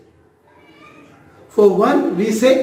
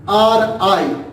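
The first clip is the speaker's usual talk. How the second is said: ordinary talk, nothing marked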